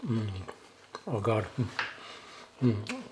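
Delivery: ordinary talk, nothing marked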